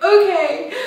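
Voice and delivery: high, squeaky little okay; mock emotional mess. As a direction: imitate yourself barely holding it together. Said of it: "I was a mess".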